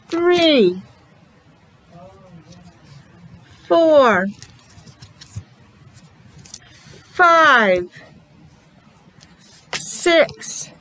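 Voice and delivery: put-on teacher counting for a small child; each number drawn out for the class; slow counting demo. Shown as a demonstration. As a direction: count out slowly, one by one. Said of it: "Let's count to eight".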